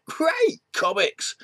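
high-pitched delighted cheer; overjoyed, played for laughs; mock excitement. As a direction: squeal it like an eager kid. Acts out finding a comics parcel.

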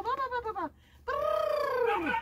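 silly lure noises. Real, not acted.

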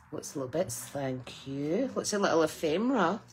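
usual speaking voice

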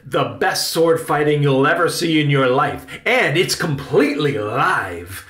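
rousing theatrical pitch; excited boasting. Acted. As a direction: sell it big, like a proud actor. Uncle excitedly touts sword fights.

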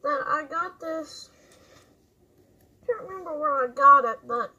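muffled behind respirator; speaking inside full face mask; through a gas mask. Real, not acted. Seen 2: Full-face respirator with filters worn over mouth throughout clip.

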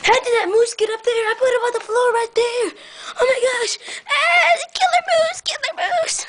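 high, alarmed cry rising to a squeal; hammed-up terror at a killer; mock panic. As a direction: build from shocked wail to shriek. Alarmed by moved toy moose.

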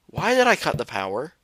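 ordinary delivery

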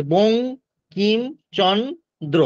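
বং, কিম, চন, দ্র with a pause after each; syllable by syllable. Shown as a demonstration. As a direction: sound out বং / কিম / চন / দ্র separately. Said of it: "এইরকম ভাবে আমরা".

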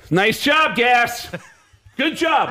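acted scolding, punched out high; sarcastic praise. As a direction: praise him as a rebuke. Friend sarcastically blames teller.

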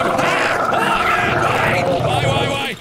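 loud, very high wailing; panicked scream. Real, not acted. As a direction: scream in protest under attack. Attacked in game.

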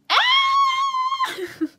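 long, very high, loud shriek of disbelief; shocked scream. Real, not acted. Streamer learns her word is dialect, shocked scream. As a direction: shriek a drawn-out "eh?" that breaks into laughter. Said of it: "なぜ悲鳴？".

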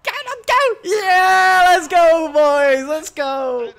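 high, loud celebratory yell easing down; triumphant cheer. Real, not acted. Player cheering teammates on a goal, triumphant shout. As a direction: cheer loudly from a high start.

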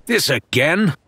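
snarled. Acted. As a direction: snap back with irritation. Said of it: "he snarled".

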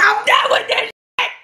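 quit the game yelling; angry shout. Real, not acted. Quitting the game.

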